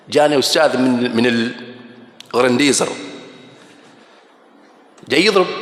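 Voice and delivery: ordinary delivery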